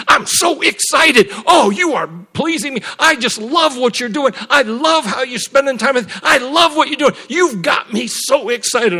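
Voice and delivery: high, loud, gushing delight; acted-out overjoyed praise, pouring out; Spirit of God. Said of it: "when he started talking to me like that".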